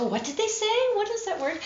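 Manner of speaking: high-pitched mock confusion; puzzled listener. Confused listener rewinding the audiobook, high puzzled voice. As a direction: play a baffled listener, pitched well up.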